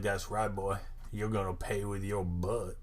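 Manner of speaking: low, soft leering driver voice; a lightly voiced sleazy trucker; creepy driver. Driver leering at the boys in his truck, low and soft.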